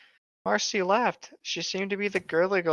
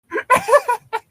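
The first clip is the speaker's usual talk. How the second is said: loud high laugh; high cackling fit; 8 dB louder than usual. Real, not acted. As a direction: burst out laughing loudly. Cracks up, can't continue.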